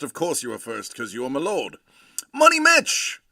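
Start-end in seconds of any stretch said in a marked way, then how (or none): 2.3-3.2 excited greeting. Real, not acted. Greets viewer in chat.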